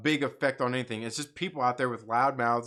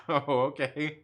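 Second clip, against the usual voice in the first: usual speaking voice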